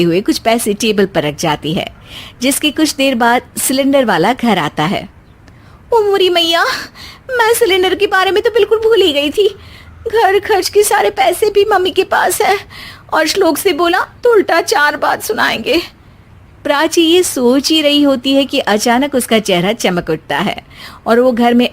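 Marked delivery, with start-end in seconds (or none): 5.9-15.8 high, fast, near-tearful fluster; panicked wife. Acted. Wife panicking alone about paying the cylinder man, high and fast.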